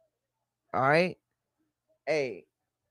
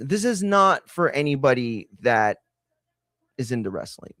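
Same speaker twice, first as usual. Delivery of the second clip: ordinary talk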